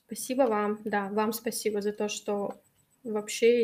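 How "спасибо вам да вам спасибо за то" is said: ordinary talk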